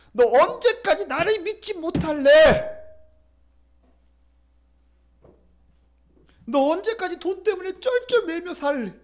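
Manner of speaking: God scolding his doubting people, rising. Acted. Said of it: "하나님께서 말씀하시".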